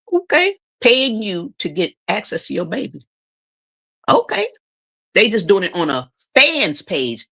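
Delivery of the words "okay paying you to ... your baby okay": high mocking okays; sarcastic. Real, not acted. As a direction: needle them with sarcasm.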